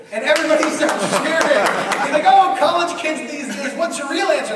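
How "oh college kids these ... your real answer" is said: loud, higher joking host voice; host mocking the contestant, loud and amused; teasing host. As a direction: rib the kid like a TV host. Drew Carey teasing contestant.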